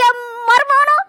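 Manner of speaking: hammed-up crying. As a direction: fake weeping played big for show. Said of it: "ஒரு கோடிக்கு நடிக்கும்".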